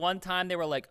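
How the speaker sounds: ordinary talk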